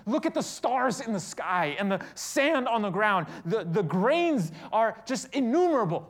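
loud, high-pitched sermon delivery; impassioned preaching. Acted. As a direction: preach it out with big stressed words. Preaches at full force.

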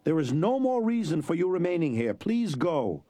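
a proud craftsman turning a buyer out; Domingo's voice. A narrator's reading.

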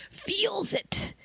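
"Feels" breathed out in a whisper; tired editor whispering "Feels it". Shown as a demonstration. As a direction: whisper it like a weary secret.

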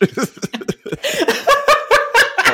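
high, loud gleeful laugh; gleeful cackle. Real, not acted. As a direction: cackle with glee. Laughs after karma jab.

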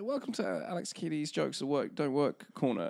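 mock segment announcer. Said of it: "announces podcast segment".

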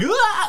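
shrill little cartoon shriek; high-pitched bat shriek. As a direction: squeal like a startled bat. Imitating a screaming bat.

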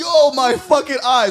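high, loud, drawn-out yelp; acted pained outcry. Reliving a punch in the eye for friends, loud outcry. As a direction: re-enact crying out at a hit, loud and high.

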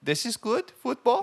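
high-pitched bad German accent; bad foreign accent. Co-host failing at accents, bad German accent. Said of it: "I wish I was better at accents".

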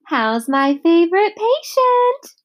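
high, slow, sweet nurse voice. A narrator's reading. Nurse greeting the little brother, high and sweet. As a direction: coo it like a doting nurse.

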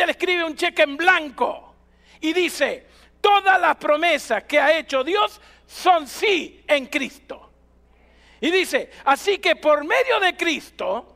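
declamatory pulpit delivery, weighty pauses; emphatic preaching. Real, not acted. Pastor tells church of God's blank cheque, emphatic preaching. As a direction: proclaim it with total conviction, stretching key words. Preaches and reads verse at full force.

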